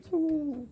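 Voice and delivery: high "ooh" like a slicing blade; mock martial cry, high and cutting; katana-like cry. Shown as a demonstration. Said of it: "cut you like a katana blade".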